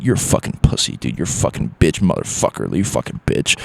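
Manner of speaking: put-on low, soft, slurred drunk insults under the breath; drunk trash talk. Comic imitates a drunk bar guy taunting him, mumbled. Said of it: "kinda, like, talking shit".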